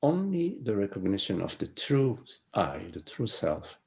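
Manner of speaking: hushed, unhurried guiding voice; soft spiritual teaching. Acted.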